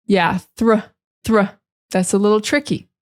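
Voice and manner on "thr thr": thr isolated, TH into R; teacher breaks thr out for students; thr cluster. Shown as a demonstration. Teacher isolating the thr cluster. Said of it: "from the T H to the R".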